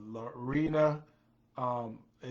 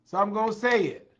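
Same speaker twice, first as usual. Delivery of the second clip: higher and louder, pushing out; declaring it; 7 semitones higher, 7 dB louder than usual. Acted. Host fired up to speak his mind, loud and high. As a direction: announce it with your chest.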